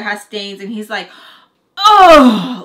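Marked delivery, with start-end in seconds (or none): 1.8-2.6 loud high shocked "Oh"; really loud cry. Retold or imitated. Husband finding stained sheets, loud high cry. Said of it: "like, really loud".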